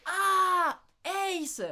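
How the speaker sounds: high, loud drawn-out cry of getting it; loud realisation. Retold or imitated. Learner finally grasping the Canadian's word, loud acted cry. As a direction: act out the penny dropping, loudly. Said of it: "Oh putain, je fais".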